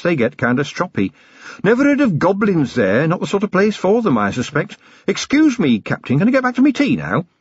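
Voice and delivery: high-pitched, chatty, good-natured constable; jolly constable. Acted. Jolly constable recalling a trip to her captain, chatty. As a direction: chat away cheerfully in a high voice.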